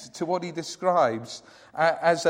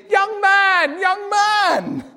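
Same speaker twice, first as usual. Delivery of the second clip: put-on high, loud old woman's call across the church; high quavering old lady calling young man; 10 dB louder, much slower than usual. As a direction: call him like a frail but urgent old lady. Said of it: "began to wave and call to me".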